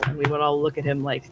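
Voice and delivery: ordinary talk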